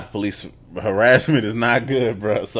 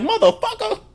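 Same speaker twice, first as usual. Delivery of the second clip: very high, laughing curse; laughing shriek. Real, not acted. Reacting to the cop's insult, very high laughing curse. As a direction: squeal it with a laugh. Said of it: "I was like".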